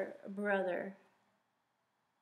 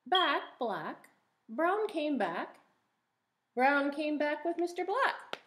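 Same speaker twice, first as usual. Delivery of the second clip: bright sing-song read-aloud, a touch higher; reading a picture book aloud; 5 semitones higher, 3 dB louder than usual. Acted. Teacher reading a rhyming book to the class, sing-song. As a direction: read each rhyme out to little listeners.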